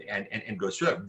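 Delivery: normal talking voice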